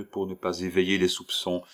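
ordinary delivery, nothing marked

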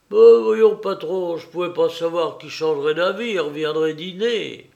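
loud, high opening, then easing; indignant henchman's self-justification; protesting excuse. A narrator's reading. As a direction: defend yourself to an annoyed boss. Henchman protests at boss's scolding.

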